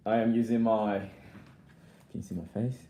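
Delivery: ordinary talk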